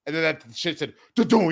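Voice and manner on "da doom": imitated booming thud; loud tractor crash noise. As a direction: shout the crash sound.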